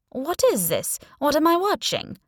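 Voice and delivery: high-pitched put-on Italian accent. A narrator's reading. Heroine questioning the woman about the video, Italian accent. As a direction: keep up a feigned Italian accent while questioning. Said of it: "keeping up the Italian accent".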